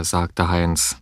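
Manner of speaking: ordinary delivery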